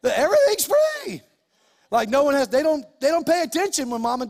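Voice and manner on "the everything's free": put-on high, loud spoiled-kid whine; mock entitled kid. Pastor mimics kids who think everything is free, mock whine. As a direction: brat who thinks it's all free. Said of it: "It's like".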